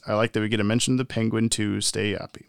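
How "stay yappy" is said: ordinary talk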